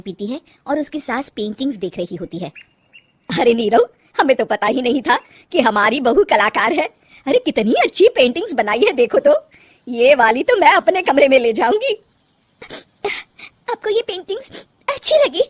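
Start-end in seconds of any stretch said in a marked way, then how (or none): 3.3-11.9 loud, impressed mother-in-law; delighted mother-in-law. Acted. 13.7-15.4 very high, weepy question; sniffly, disbelieving young wife; tearful surprise. Acted. Crying wife surprised by praise.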